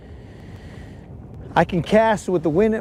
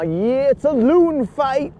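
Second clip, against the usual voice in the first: excited; 8 semitones higher, slower than usual. Real, not acted. Excited wildlife exclaim.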